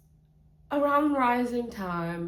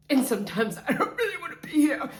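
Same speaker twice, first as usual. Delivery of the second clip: fast, higher, laughing through it; 6 semitones higher, 4 dB louder, faster than usual. Real, not acted. Confessing to viewers, laughing and fast.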